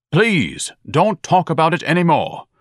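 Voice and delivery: high, loud, wounded protest; exasperated plea. Acted. Pompous son silencing his mother, exasperated. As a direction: cut them off as if deeply hurt.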